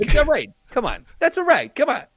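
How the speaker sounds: high, short mock movie-Italian phrases; hint of mobster pleading. Riffing on Pacino in Godfather, high mock pleading. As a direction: toss out pleas in a high put-on voice.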